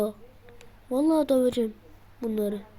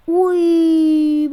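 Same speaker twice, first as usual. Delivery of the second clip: surprised shout; 3 semitones higher, 10 dB louder than usual. Real, not acted. Shot flies in.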